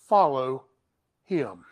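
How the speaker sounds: "follow him" dropped low and soft, almost under the breath; hushed emphasis. Shown as a demonstration. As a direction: let "follow" sink into a hush. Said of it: "the emphasis on this word".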